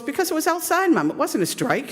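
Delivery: put-on the boy as if it's plain logic; matter-of-fact kid. Son answers his mother.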